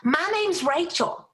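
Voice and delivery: high, chirpy voice of a friendly stranger; Rachel. Retold or imitated. Seatmate greeting the speaker on the plane, high chirpy voice. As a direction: pitch way up, bubbly and eager. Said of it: "She said".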